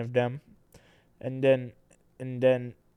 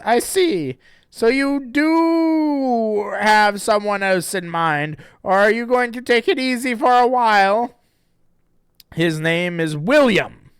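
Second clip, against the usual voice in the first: character voice; 10 semitones higher, 10 dB louder than usual. Acted. Reading the comic dialogue.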